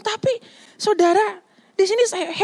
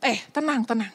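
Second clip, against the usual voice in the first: soft calming; 6 semitones lower, 7 dB quieter than usual. Retold or imitated. Jesus calming frightened disciples.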